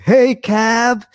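high, loud call-out; imitated yell. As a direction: imitate a skater yelling across the park. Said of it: "yelling at me".

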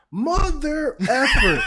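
loud laughing slow outburst; drawn-out shout through laughter. Real, not acted. Caught by co-host's joke.

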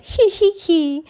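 very high, loud tee-hee; mocking giggle. A narrator's reading. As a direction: squeal out a put-on, spelt-out giggle. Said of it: "and then he laughed".

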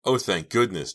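oh-thank-goodness relief. A narrator's reading. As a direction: exclaim it, thankful help has come. Relieved thought at rescue.

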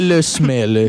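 le smell in a mock French accent. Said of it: "It turned French right at the end".

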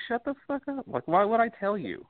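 high, exasperated imitation of telling a fan off; mock exasperated retort. Mocking reply to nosy fans.

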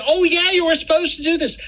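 high, fast mocking doctor voice. Patient mimicking doctors, high fast mock voice. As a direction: sneer it like a smug doctor.

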